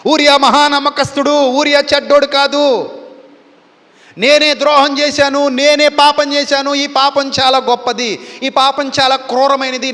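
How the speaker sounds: high, loud anguished confession of guilt; weeping confession. Acted. King confessing his sin before God, loud anguished crying. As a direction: cry out your sin, voice lifted and pleading. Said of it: "అని ఏడవడం మొదలుపెట్టాడు".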